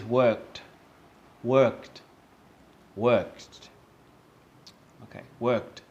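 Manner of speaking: "worked" as one syllable ending in a T sound; model pronunciation. Shown as a demonstration. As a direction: demonstrate "worked" for repeating, crisp final T. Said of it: "repeat after me and see if you can notice".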